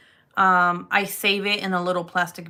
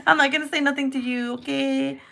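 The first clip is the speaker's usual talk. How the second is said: higher, rushed promise; fast meek promise; 6 semitones higher than usual. Retold or imitated. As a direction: hurry to keep the peace. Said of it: "I'm kinda like".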